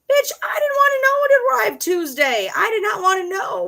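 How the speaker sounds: high, loud exasperated yell; outraged shout. Acted. Ranting at Amazon's pop-up, outraged high shout. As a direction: shriek it at the screen.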